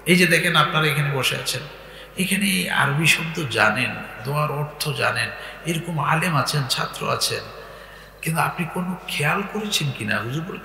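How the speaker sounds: ordinary talk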